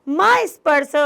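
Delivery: a touch of angry warning. Acted. Mallika warns Chandan off the laddoos, mildly angry. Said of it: "सक्रोधम".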